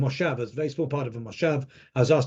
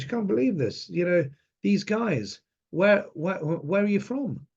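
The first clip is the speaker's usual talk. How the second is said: pitched-up disbelieving chatter; retold amazement; 6 semitones higher than usual. Shop girl to her friend, high amazed voice. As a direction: play her excited shock, high.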